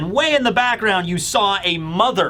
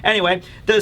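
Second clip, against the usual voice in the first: usual speaking voice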